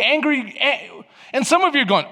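normal talking voice

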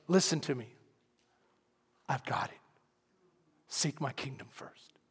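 low, soft reassurance fading to a whisper; near-whispered, unhurried command; hushed divine voice. Retold or imitated. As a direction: reassure quietly, sinking into a whisper. God's quiet answer in story.

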